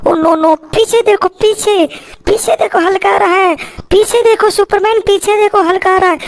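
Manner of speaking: high, urgent cry to look behind; alarmed warning. Pretend. Toy Superman warned that Hulk is coming, alarmed.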